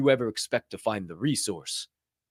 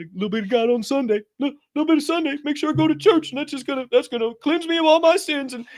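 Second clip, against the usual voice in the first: much higher, sing-song voice of a lukewarm churchgoer; mocking Sunday churchgoer; 12 semitones higher, 4 dB louder, faster than usual. Preacher mocks Sunday-only Christians, high hammed-up voice. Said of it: "That's how stupid you look".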